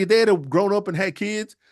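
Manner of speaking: normal talking voice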